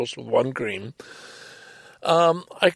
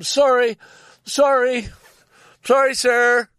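high, raised calling-out voice; yelled apology; 8 semitones higher, 4 dB louder, slower than usual. Retold or imitated. Driver yelling to the man he bumped, apologetic call. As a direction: call the apology out through a car window. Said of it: "I'm yelling".

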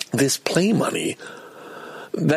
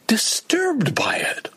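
high, anguished wail; disturbed; 11 semitones higher, much slower than usual. Real, not acted. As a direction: drag it out in a high, upset cry. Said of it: "I'm just, just too disturbed by it".

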